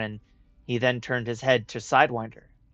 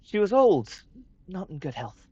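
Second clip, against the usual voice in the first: soothing; 3 semitones higher than usual. Acted. Mother comforting son.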